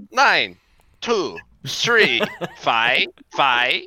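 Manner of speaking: mock Chinese accent reading numbers. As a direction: read the digits in a Chinese accent. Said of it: "a really thick Chinese accent".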